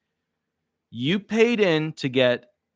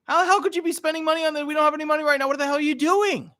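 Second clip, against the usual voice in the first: very high shrill mock wife, fast; a wife scolding her husband for spending money they lack; outraged wife; faster than usual. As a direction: screech at him in disbelief. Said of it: "And then she says".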